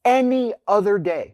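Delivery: "Any other day" pitched high, each word weighted; emphatic. Real, not acted. Husband repeating his excuse to the interviewer, emphatic.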